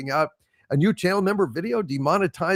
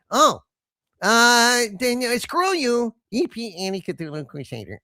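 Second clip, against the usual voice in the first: puppet voice; 6 semitones higher than usual. Acted. Puppet Harvey corrects his insult.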